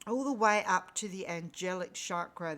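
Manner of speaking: ordinary delivery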